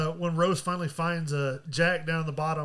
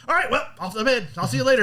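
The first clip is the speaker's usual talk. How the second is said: higher, breezy goodnight; lightly voiced carefree captain; 5 semitones higher, 5 dB louder, faster than usual. Captain off to bed despite icebergs, mock breeziness. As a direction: sign off blithely, a little louder.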